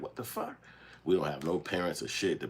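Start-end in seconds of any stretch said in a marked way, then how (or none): 0.0-0.6 very high, disbelieving squeak; high, squeaky teen disbelief; shocked. Retold or imitated. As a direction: caught off guard by the rumor. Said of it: "we up in there like".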